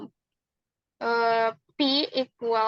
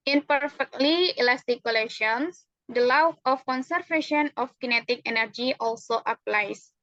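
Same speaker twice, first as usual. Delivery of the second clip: ordinary talk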